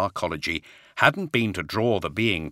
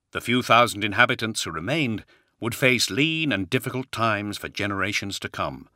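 ordinary delivery

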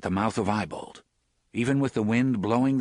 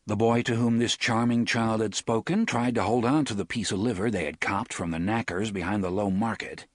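ordinary delivery

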